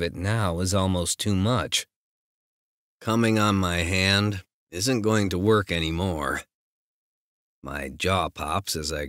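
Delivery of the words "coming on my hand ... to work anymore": plain reading voice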